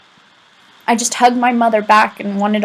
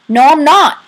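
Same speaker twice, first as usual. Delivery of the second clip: loud, higher snap of denial; grieving friend shouts his denial, higher and louder; angry denial; 6 semitones higher, 7 dB louder than usual. A narrator's reading. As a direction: bite the words off at him. Rowdy angrily denies.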